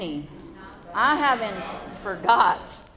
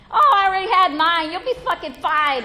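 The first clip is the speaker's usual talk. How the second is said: a touch of breezy sponsee; 7 semitones higher, 9 dB louder than usual. Retold or imitated. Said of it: "I was like".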